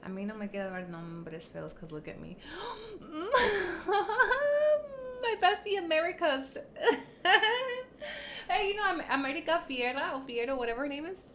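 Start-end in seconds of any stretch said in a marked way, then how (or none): 2.4-5.2 high gasp breaking into laughter. Real, not acted. Laughing at viewer's comment. 6.6-8.4 high squealing laugh. Real, not acted. Laughing at viewer's comment.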